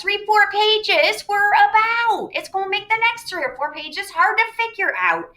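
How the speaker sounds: high, escalating teacher's complaint; hammed-up exasperation; exasperated exaggeration. Real, not acted. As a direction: climb high and stretch it out for effect. Exaggerating how much was missed.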